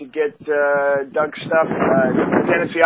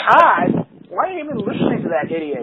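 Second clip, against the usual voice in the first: incredulous crowd; 7 semitones higher than usual. Retold or imitated.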